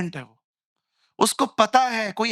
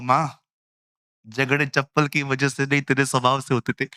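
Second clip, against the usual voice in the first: lower, teasing son telling mother; 5 semitones lower than usual. Retold or imitated. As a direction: lower voice, gently scolding mom. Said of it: "मैं अपनी माँ को बोलता हूँ". (नीची आवाज़ में माँ को छेड़ता बेटा)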